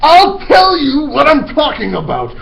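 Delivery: loud high slow tirade of insults; ranting headmistress. Acted. Trunchbull denouncing the boy to the whole school. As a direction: spit out every insult with disgust.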